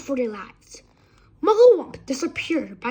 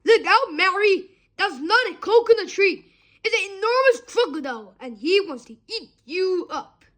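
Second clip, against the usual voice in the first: 1 urgent warning; 7 semitones higher than usual. A narrator's reading. Hippo keeps warning the children.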